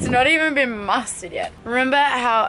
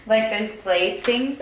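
ordinary talk, nothing marked

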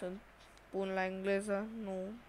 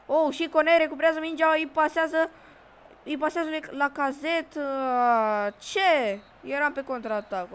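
higher and louder, racing commentary then a stunned what; excited play-by-play; 4 semitones higher, 8 dB louder than usual. Real, not acted. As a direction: call the counterattack live. Counterattack, then disbelief. (comentariu live, agitat)

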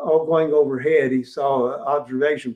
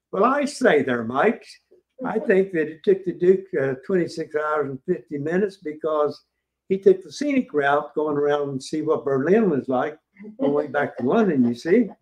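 a lightly put-on posh British accent; mock British accent; 2 semitones higher than usual. Tour guide mimics a British visitor's mock British accent. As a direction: play the chummy British guy lightly. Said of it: "I used that dumb accent".

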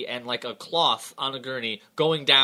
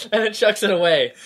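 ordinary talk

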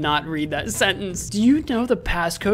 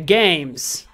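ordinary talk, nothing marked

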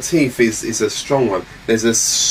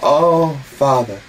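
mock reverential prayer; 3 semitones higher, 3 dB louder, slower than usual. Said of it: "very reverential picture, of Jesus saying".

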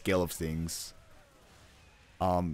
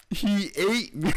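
amused echo; 10 semitones higher, 4 dB louder, slower than usual. Acted. Echoing the funny line again.

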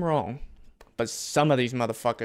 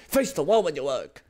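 light mock repeat; parroting the character, amused; a hint of the character's tone; echoing the game line. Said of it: "That's what I'm talking about".

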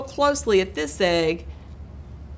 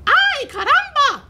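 high-pitched, louder squawking exclamation; excited chicken cry; 5 dB louder than usual. A narrator's reading. Chicken finds the nest, crying out excitedly. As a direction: cry out as a delighted hen, voice way up. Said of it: "she cried".

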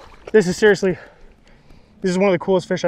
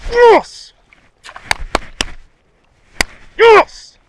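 real high, loud victory yell; triumphant shout; 5 dB louder than usual. Real, not acted. Celebrates after releasing the big fish.

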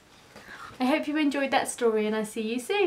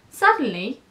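usual speaking voice

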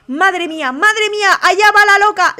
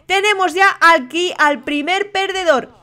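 ordinary talk, nothing marked

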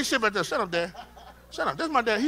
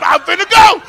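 usual speaking voice

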